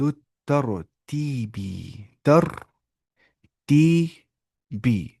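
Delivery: الترتیبی stretched very slowly; drawn-out model term. Shown as a demonstration. Teacher to students, drawn-out model of الترتیبی. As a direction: spell out ترتیبی syllable by syllable. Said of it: "اسے بولتے العدد".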